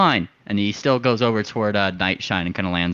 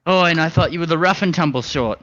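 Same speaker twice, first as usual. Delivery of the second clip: pitched up and taunting; high bird voice, mock disappointed; 7 semitones higher than usual. Goading the sailors, higher and mocking. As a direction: needle their pride with a sneer. Character baits the sailor leader.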